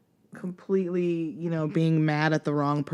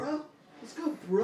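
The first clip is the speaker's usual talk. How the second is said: gym bro; 7 semitones higher, 3 dB quieter than usual. Retold or imitated.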